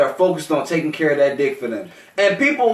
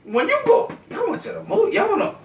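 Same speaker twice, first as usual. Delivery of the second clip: mock outrage. Acted outrage at girlfriend.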